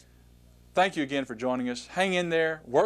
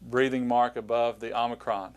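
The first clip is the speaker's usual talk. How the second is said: ordinary delivery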